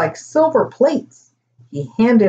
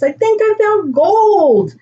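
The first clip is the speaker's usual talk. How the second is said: high, drawn-out thrill at a find; excited yelling out; 11 semitones higher, 3 dB louder than usual. A narrator's reading. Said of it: "yelled out Chase".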